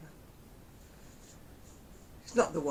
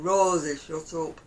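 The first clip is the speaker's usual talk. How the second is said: broad Oldham yell, louder on the name; Coronation Street accent; 8 dB louder, slower than usual. Retold or imitated. Telling off Rosie the dog, broad Oldham accent. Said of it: "I sound very Coronation Street when I'm going".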